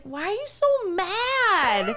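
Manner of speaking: high, loud, stretched-out question; laughing mock confrontation, drag it out; exasperated challenge. Server challenges moody customer, exasperated.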